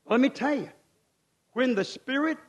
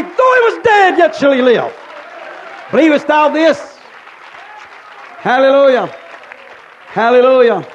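soaring shouted preacher's cry, much higher and louder; preaching at full force; 10 semitones higher, 15 dB louder than usual. Acted. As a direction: lift the church with every word.

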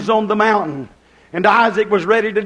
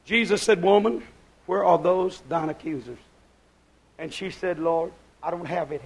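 very soft, lowered voice; hushed retelling; 3 semitones lower, 8 dB quieter than usual. Acted. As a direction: let the scene drop almost to nothing. Hushed retelling of Jesus and woman.

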